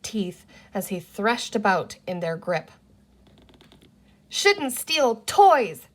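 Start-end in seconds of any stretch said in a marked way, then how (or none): 4.3-5.9 slow, high aggrieved scolding; grieved. A narrator's reading. Boy scolds the creature for stealing, grieved and slow. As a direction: drag it out, hurt and reproachful. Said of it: "in a grieved voice".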